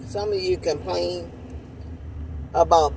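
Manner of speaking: talking around a spoon; eating ice cream while talking; spoon at lips. Real, not acted. Seen 1: Spoon with ice cream rests at lip corner while she talks.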